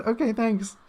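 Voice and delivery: bewildered thanks. Retold or imitated. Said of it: "I just said".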